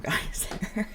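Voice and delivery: usual speaking voice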